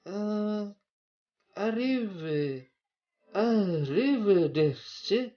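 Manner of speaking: high, loud, slow arrivederci; Italian accent. Shown as a demonstration. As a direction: sing out arrivederci Italian style. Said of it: "sudah bisa logat Italia saya".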